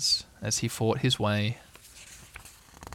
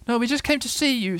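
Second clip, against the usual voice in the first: high-pitched little pig; Piglet; 11 semitones higher than usual. A narrator's reading. Little pig reassures Eeyore, high character voice. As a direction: pipe up in a small animal's voice. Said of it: "said Piglet".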